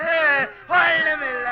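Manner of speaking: slow, high, wailing sob; hammed-up crying. Man denying anything is wrong, bawling. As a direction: bawl while insisting all is fine. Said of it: "என்னன்னு சொல்லிட்டு அழுடா".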